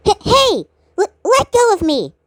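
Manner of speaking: flustered stammering protest; startled protest. Acted. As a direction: stutter in shock at being grabbed. Maroon struggling to break free.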